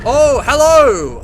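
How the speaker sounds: loud startled yelp, drawn out. Real, not acted. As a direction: jump at the scare. Jump scare in game.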